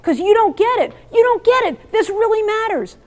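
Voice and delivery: imitating a frustrated teenager pushing back; mock upset kid. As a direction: play the kid who feels unheard.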